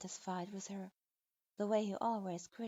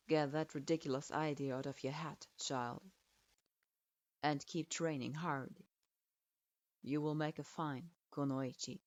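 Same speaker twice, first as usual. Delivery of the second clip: much lower, condescending stern lord; patronizing; 6 semitones lower than usual. Acted. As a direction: talk down to the child, dismissive.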